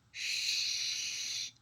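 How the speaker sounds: soft, breathy shush; gentle storyteller shush. Pretend. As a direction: hush like you might scare a bug. Must be very still.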